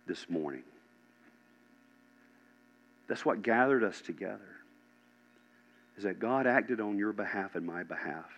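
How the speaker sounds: soft, low preaching with long pauses; hushed, solemn preaching. Real, not acted. Preacher telling the congregation Jesus died for them, hushed. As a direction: bring it down softly and let it sink in.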